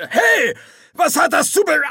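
high, sudden puzzled outburst; startled demon. A narrator's reading. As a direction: cut off in confusion. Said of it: "Eine kehlige Stimme erklang".

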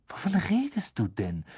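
hoher, leicht gereizter Ton; zornig. A narrator's reading. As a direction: mit verhaltenem Zorn nachhaken. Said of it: "sagte Harry zornig". (etwas zornig)

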